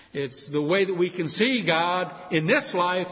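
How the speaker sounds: ordinary delivery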